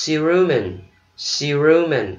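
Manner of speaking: "cerumen" said si-ROO-men; stress on middle syllable. Shown as a demonstration. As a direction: lean on the "ru" in "cerumen". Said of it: "Nhấn âm giữa".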